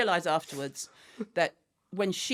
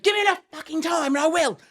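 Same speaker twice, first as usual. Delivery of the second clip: high, loud retold yell; angry; 9 semitones higher, 7 dB louder than usual. Mum threatening her teenage daughter, angry yell. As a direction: explode in a Scouse-London temper. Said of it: "when I'm angry, it's a mixture".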